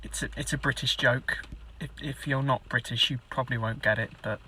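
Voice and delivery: low, soft mumbling aside; sheepish explanation. Acted. Explaining a British joke to viewers, sheepish and quiet. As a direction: drop right down, hesitant and quiet.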